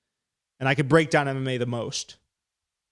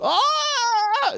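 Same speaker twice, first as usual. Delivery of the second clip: a very high scream that breaks off; cracked scream. Retold or imitated. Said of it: "It sounded like that".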